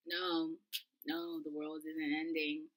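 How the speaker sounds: low, soft and slow; calm her down quietly; retold hushed reassurance; reassuring denial. Reassures tripping roommate.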